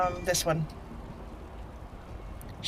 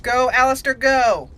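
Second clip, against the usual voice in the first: cheering; 5 semitones higher, 7 dB louder than usual. Real, not acted. Cheering companion into battle.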